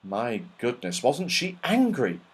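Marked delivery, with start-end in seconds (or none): none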